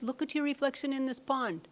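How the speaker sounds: lightly acted lion, pitched high; insistent lion. New lion showing sheep lion the pond, lightly acted high-pitched insistence. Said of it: "to a pond that's very still and says".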